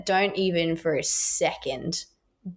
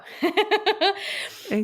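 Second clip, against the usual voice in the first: high-pitched burst of laughter; 12 semitones higher than usual. Real, not acted. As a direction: laugh at your own answer. Laughs at her own answer.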